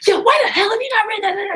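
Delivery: retold mom yelling. Mom yells about unwritten letter.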